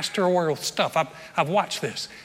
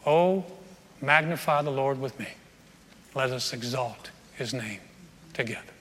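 scripture reading; 4 semitones lower, 3 dB quieter than usual. Acted. Reads Psalm 34.

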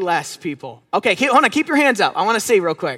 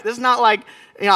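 ordinary talk, nothing marked

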